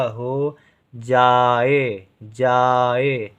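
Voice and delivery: हो जाए drawn out very slowly; very slow dictation. Shown as a demonstration. Teacher to pupil, very slow dictation of हो जाए. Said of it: "जा के साथ आ का उच्चारण".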